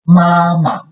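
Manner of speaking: 妈妈 high and level, then short and light; first plus neutral tone. Shown as a demonstration. Teacher reads an example, 妈妈 with unstressed second syllable. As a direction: hold the first 妈 high, drop the second 妈 briefly. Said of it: "this neutral tone is after a first tone syllable".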